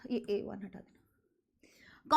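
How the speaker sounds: very soft, low whisper; whispered aside. Real, not acted. Speaker asking for a cut mid-lesson, whispered aside. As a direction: drop to a whisper, off the record. Aside to editor to remove something.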